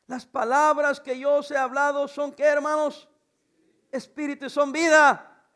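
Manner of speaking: slow, loud scripture proclamation building up; preaching at full force. Acted. Pastor proclaims John six to the congregation, preaching loud and slow.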